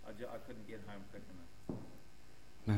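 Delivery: slow, moved, voice catching a little; real emotion held in check, slowed down; emotional. Real, not acted. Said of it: "just the emotion is still there".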